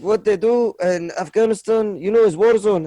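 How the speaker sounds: put-on Afghan accent. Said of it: "I need to do the accent".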